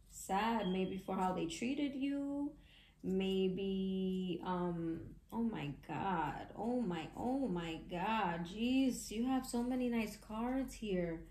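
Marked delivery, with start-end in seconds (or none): none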